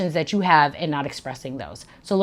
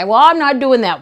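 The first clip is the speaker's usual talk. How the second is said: mock indignant huff; 7 semitones higher, 10 dB louder than usual. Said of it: "suck your teeth and say".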